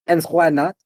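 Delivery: loud, high retort in character; challenging innkeeper. Acted. Innkeeper reaching for the basket turns round, challenging. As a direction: throw it back high and loud.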